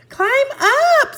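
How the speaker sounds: urgent little sister; urgent girl. A narrator's reading. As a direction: hurry him up as a little girl. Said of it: "said Annie".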